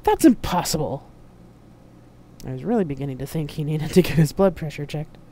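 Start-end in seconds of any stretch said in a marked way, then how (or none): 0.0-1.1 loud, incredulous outburst; outraged bellow. A narrator's reading. Flushed admiral rejects the woman's claim, bellowing. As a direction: burst out in loud disbelief. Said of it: "bellowed the Admiral, flushing red once again".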